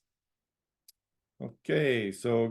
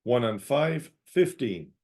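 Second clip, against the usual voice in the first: calling each number out, then repeating it; sing-song bingo number calls; bingo caller. Acted.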